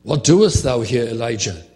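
loud, searching question; the Lord's challenge. Acted. God confronting Elijah in the cave, loud. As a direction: call him out firmly. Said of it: "the word of the Lord came to him".

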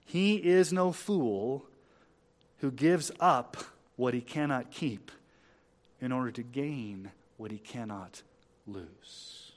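very deliberate, with pauses between phrases; measured, spaced-out delivery; slow quotation. Shown as a demonstration. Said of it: "I'll say it slow".

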